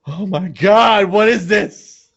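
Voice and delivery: high, slow panicky cry; frightened child's wail; scared kids. Retold or imitated. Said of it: "they were like".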